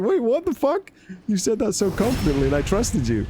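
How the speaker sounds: a betrayed squeal of disbelief; outraged disbelief. Retold or imitated. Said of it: "you're like".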